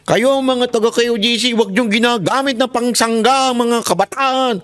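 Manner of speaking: mock Abalos. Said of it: "Sabi ni Abalos".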